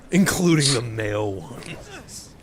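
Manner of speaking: usual speaking voice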